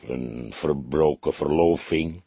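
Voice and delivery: lager en traag, de oude kok stelt het vast; rustige vaststelling. Acted. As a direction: kalm en langzaam uitgesproken, verloving licht omhoog. Kok answers, Fledder echoes. (de kok zegt het rustig en traag tegen Fledder)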